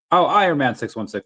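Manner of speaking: ordinary talk, nothing marked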